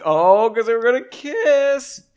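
high, loud, drawn-out tease; teasing singsong. Pretend. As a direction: tease them loudly and slowly about romance. Teasing the characters about kissing.

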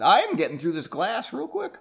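mid-sip casual aside; drinking while talking. Real, not acted. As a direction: talk between gulps of a drink. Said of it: "let's have a drink".

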